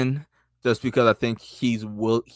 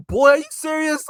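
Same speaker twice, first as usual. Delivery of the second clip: incredulous; 6 dB louder than usual. Retold or imitated. Quoting Bruno's disbelief.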